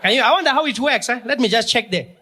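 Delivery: high, wide-eyed foreign-sounding voice; a man from the past amazed by moving stairs, in a high marvelling voice; amazed old-timer. Acted. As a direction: marvel at it like it's brand new. Character asks Siri.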